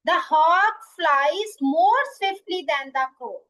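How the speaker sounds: very slow example sentence, word by word; slow dictation. Shown as a demonstration. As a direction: dictate it so students can write. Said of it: "teacher dictates example sentence". (धीमी, शब्द-दर-शब्द लिखवाने वाली आवाज़)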